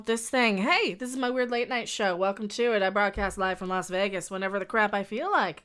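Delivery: loud, brash late-night host intro; show host. Acted. Opening a weird late-night show to viewers, brash and loud.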